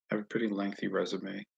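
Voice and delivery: normal talking voice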